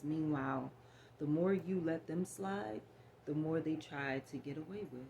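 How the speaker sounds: ordinary talk, nothing marked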